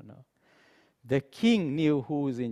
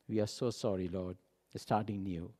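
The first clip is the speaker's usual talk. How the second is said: contrite prayer; 6 semitones lower, 7 dB quieter than usual. Pretend. Models a repentant prayer.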